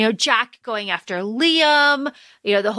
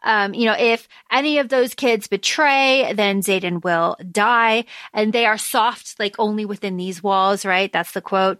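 ordinary delivery

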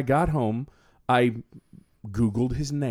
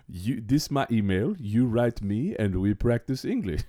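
slow, halting imitation of a Chadian accent; imitated Chadian accent; 2 semitones lower than usual. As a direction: imitate a Chadian man's careful broken English. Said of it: "And he says".

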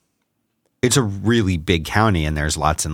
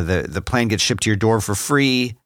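ordinary talk, nothing marked

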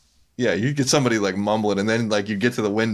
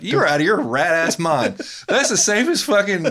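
high, louder incredulous outburst; exasperated retort; 11 semitones higher, 4 dB louder than usual. Retold or imitated. Retelling argument with coworker, exasperated outburst. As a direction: fire it back in disbelief. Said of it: "I'm like".

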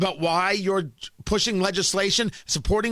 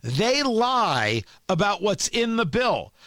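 normal talking voice

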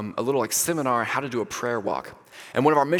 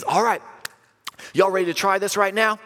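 raised, upbeat call to the group; rally everyone with a higher voice; rallying group leader; 6 semitones higher than usual. Retold or imitated. Prayer walk leader asking the group to start, upbeat call.

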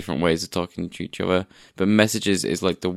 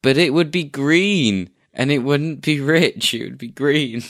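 mock dismay; 7 semitones higher than usual. Mock-dramatic complaint about green bubbles.

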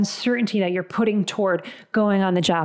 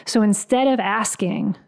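ordinary talk, nothing marked

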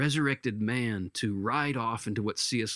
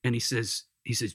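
ordinary talk, nothing marked

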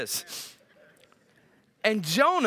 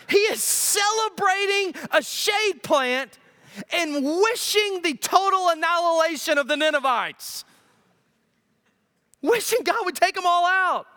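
fired-up preaching; 7 semitones higher than usual. Real, not acted. Preacher ramps up on Jonah.

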